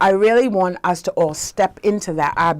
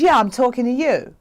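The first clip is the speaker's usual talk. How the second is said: imitated man calling out, pitched up; insistent call; 6 semitones higher, 3 dB louder than usual. Guy from outside the barber shop to her, insistent raised call. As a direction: insist to someone across the street. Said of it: "like that".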